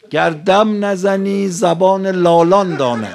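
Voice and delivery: loud, slow, drawn-out line of poetry; verse recitation. Real, not acted. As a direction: declaim the verse high and measured to the hall.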